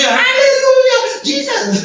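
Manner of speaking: put-on high shrieking kid's tantrum; screaming child. Pastor imitates his child screaming when the phone is taken. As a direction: shriek like a toddler losing the phone.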